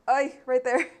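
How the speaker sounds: a sharp real cry of pain at the sore spot. Real, not acted. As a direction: wince out loud as it hurts. Pain when moving the injured arm.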